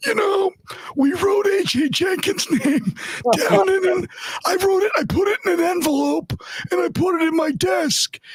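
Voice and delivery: very high, put-on impersonation; Trent Baalke. Host retelling the draft envelope anecdote as Baalke, high-pitched impersonation. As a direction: push the voice way up high to mimic him. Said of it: "it's like Trent Baalke, he's like".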